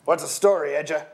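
loud hail to a rider; calling out. Acted. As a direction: call across the yard. Sentry calls to gamekeeper.

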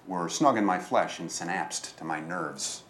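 usual speaking voice

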